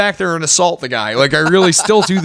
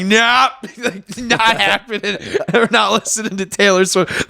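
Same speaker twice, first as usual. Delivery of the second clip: obnoxious refusal; 6 semitones higher than usual. Retold or imitated. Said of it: "I'm just like".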